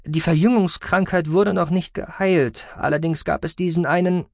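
lightly put-on high voice; high-voiced character. Acted speech of a high-voiced character.

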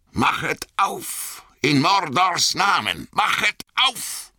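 very high, shrill threatening command; schrill, drohend. A narrator's reading. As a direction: draw out each demand in a menacing shriek. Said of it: "sagte eine schrille, drohende Stimme". (schrille, drohende Stimme)

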